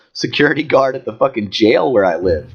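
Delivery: usual speaking voice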